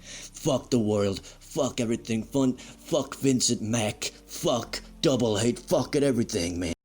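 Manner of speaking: normal talking voice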